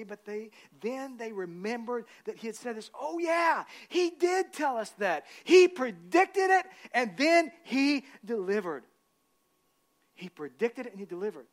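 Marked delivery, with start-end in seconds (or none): 3.0-8.8 high and loud, slow, dawning realization; excited realization. Acted. Women at the tomb remembering, high, slow and excited. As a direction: stretch it out as it dawns on them.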